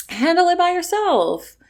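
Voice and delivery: high, pointed brush-off; a cutting, high goodbye; dismissive kiss-off. Real, not acted. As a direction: send them away for good. Telling off ex-friend directly.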